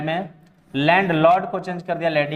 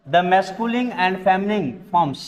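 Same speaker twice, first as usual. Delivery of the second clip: slow, careful dictation of an English rule; slow rule reading; much slower than usual. Shown as a demonstration. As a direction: read the rule out slowly, word by word. Teacher reading rule aloud slowly. (नियम को धीरे-धीरे पढ़ना)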